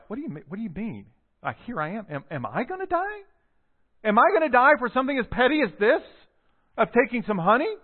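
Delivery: high, rising, fast disbelief; can't believe what he's hearing; sharp rising incredulity; incredulous. Retold or imitated. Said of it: "with a bit of incredulity".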